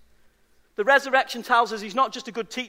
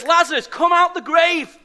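commanding call; 7 semitones higher, 6 dB louder than usual. Acted. Jesus calling Lazarus from tomb.

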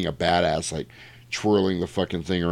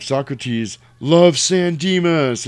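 higher, slow drawn-out proclamation; mock stage announcement; 5 semitones higher, 4 dB louder, much slower than usual. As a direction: proclaim it slowly to a crowd. Acting a shouted stage announcement.

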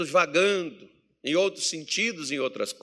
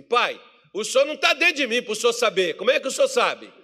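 higher-pitched imitation of a protesting son; filho contestando; 7 semitones higher, 4 dB louder than usual. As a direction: argue back like an indignant kid. Son protests to father, acted. (filho indignado, voz mais aguda)

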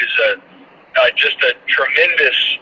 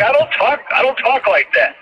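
higher-pitched, mock-indignant protest; 10 semitones higher than usual. Broadcaster objecting to colleagues' impression of him, indignant protest. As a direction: push back in a raised, disbelieving pitch.

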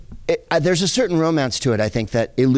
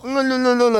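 fawning waiters; 11 semitones higher than usual. Retold or imitated. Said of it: "were like".

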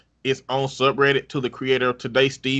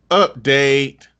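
ordinary talk

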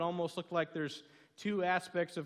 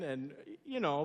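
usual speaking voice

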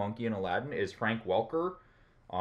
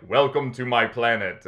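deep alien voice; 6 dB louder than usual. Retold or imitated. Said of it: "he has that deep voice".

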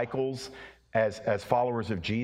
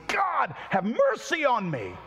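desperate cry; 11 semitones higher, slower than usual. Retold or imitated. Preacher reliving his desperate plea, crying out high for mercy. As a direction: cry out to God in desperation, pleading.

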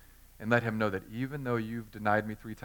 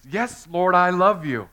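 emphatic declaration; 6 semitones higher, 10 dB louder, slower than usual. A narrator's reading. Peter insists his love.

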